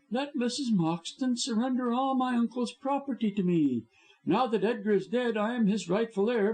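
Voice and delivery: gloating high villain voice; scheming blackmailer. A narrator's reading. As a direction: lay out the terms smugly. Blackmailer making terms.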